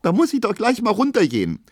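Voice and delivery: high, loud tearful resolve; sobbing outcry. A narrator's reading. Pauline wants to confront the porters downstairs, sobbing. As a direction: wail it with indignant force. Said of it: "rief jetzt Pauline schluchzend".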